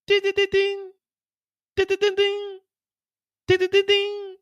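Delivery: high sing-song ding ding; timer ding. Acted. Pregnancy test timer going off, high sing-song chime.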